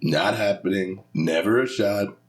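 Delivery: politician impression in short phrases; Trump. Podcaster showing off a practised impression, presidential mimicry. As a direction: punch out dismissals as an impersonated president. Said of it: "I like to do Trump".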